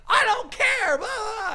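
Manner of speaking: high mocking voice, brushing it off; mocking dismissal. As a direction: squeak it out like a brat ignoring you. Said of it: "he's like".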